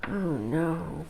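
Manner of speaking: soft dismay. Acted. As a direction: let it drop quietly. Ralph sent away.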